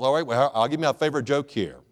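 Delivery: normal talking voice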